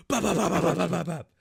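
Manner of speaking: loud, rapid-fire bop-bop gun noise; imitated gunfire. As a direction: mimic a gun spraying bullets.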